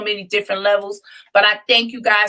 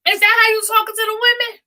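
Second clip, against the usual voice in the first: high-pitched, quick incredulous question; 11 semitones higher, faster than usual. Real, not acted. Co-host challenging the talk about women, incredulous. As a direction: call them out in a much higher voice. Co-host reacts to his swearing.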